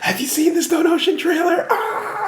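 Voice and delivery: squeaky anime fan. Acted. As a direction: squeal like an excited otaku.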